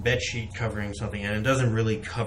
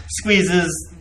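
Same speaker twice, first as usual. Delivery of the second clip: ordinary talk, nothing marked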